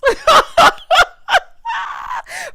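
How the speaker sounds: shrieking high laugh; loud laugh. Real, not acted. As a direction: howl with laughter. Laughs at Marie's line.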